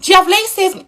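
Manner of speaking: sweet polite question. Retold or imitated. Quoting her own polite question.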